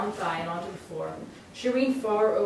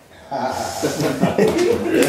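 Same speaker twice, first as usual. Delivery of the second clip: laugh; 4 semitones lower, 11 dB louder than usual. Real, not acted. Reader laughs at end.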